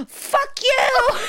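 high, loud mock scream with laughter behind it; retold angry outburst. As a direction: imitate an upset child screaming at mom. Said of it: "And she's like".

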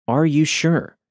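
mildly fearful question. A narrator's reading. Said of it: "she asked with more fear than suspicion".

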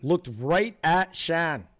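put-on slow, drawn-out Boston accent on "Shan". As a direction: say it like a Bostonian, stretching the vowels. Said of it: "I just called her Shan in the Boston accent".

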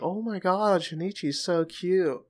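high, gushing fangirl squeal; teen girls swooning over a cute boy, gushing. Retold or imitated. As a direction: gush over him like a smitten schoolgirl. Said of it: "There's girls chatting about him".

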